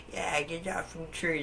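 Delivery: high, put-on old lady voice; imitated old lady. Old lady butting in from a tall building, high mock scolding. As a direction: pitch it up as a meddling old woman. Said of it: "some old lady somehow heard me".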